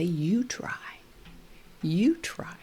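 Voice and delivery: whispered gentle prompt; soft whisper. Shown as a demonstration. Therapist softly urging the toddler to try, whispered. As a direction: coax him softly to try. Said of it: "And I say".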